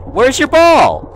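high, loud, drawn-out question; alarmed outcry. Real, not acted. Player losing the ghost girl's ball, loud dismayed cry. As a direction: wail it in dismay.

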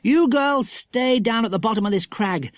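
put-on high, firm child giving orders; lightly voiced boy in charge; bossy Jack. Jack gives the girls orders.

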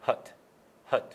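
ordinary talk, nothing marked

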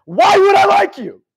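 high, drawn-out furious yell; loud, exasperated confrontation, stretched out; in-his-face shout. Retold or imitated. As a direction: bellow it slowly at full force. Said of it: "I got in his face".

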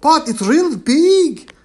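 high, loud and slow insisting. A narrator's reading. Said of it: "Elba said louder".

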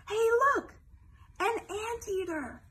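high, loud excited exclamation; excited discovery. Acted. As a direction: shout it out like a big find.

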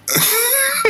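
high burst of laughter. Real, not acted. Laughing at the joke.

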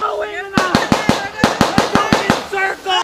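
high, loud instruction shout; shouting orders over battle. Acted. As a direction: bark directions over the noise. Instructor directing under fire drill.

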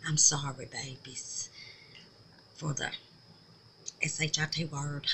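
whispered endearment; a hushed aside to viewers. Real, not acted. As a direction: breathe the word babies. Apologizing softly for the swear.

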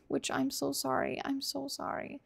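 sheepish, half to herself; guilty aside. Real, not acted. Gamer apologises after shooting a pig, soft and sheepish.

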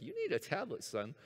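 high start dropping on "son"; lightly voiced coaxing salesman, high then falling; pushy salesman. Google pressing a tablet on the searcher, lightly voiced pushy salesman.

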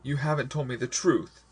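high-pitched blurted accusation; urgent, upset reproach. A narrator's reading. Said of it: "I blurted out".

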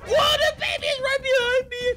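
high, loud frightened shout; scared shout; panicked yell. Real, not acted. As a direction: caught off guard. Baby suddenly behind him.

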